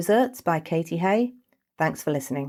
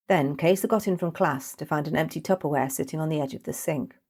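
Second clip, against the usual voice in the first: ordinary delivery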